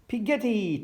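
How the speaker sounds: high little-one cheer; gleeful high-pitched cry. A narrator's reading. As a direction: cheer it out like a delighted toddler. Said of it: "cheered George".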